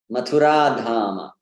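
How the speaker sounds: Mathura as ma-thu-RAAA before Dhama; short U, stressed long RA in Mathura; correct long vowel. Shown as a demonstration. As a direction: prolong the last ah of Mathura in Mathura Dhama. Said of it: "Again, the same point".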